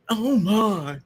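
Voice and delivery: usual speaking voice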